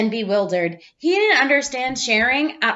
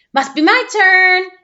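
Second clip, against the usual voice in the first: sneaky excitement; 7 semitones higher, 6 dB louder than usual. Acted. Clark grabs the toy.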